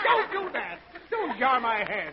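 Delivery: high, strained plea to stop; pained protest. Acted. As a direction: wince at the noise and protest. Father reacts to bawling.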